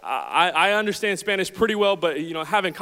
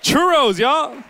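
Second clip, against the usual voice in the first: higher, louder churros call; mock exclaim; 8 semitones higher, 6 dB louder, much slower than usual. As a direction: shout it out to the room. Calling out churros to crowd.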